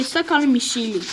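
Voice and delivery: ordinary talk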